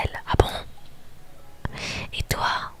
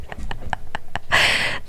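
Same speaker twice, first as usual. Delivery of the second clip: breathless stifled laugh; whispered laughter; 4 dB louder than usual. Real, not acted. Player cracking up at the game, stifled laughing. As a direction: crack up while keeping it hushed.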